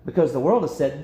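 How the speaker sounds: ordinary delivery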